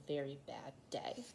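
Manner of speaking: whispered refrain, trailing off. A narrator's reading. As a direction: breathe the last words out.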